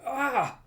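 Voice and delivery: short high cry of pain; acted yelp under attack; pained yell. Tim battered by magic, high pained yell.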